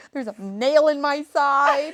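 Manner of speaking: slow, loud mock grumble; complaining. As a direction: drag it out as a whiny complaint. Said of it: "like, complaining".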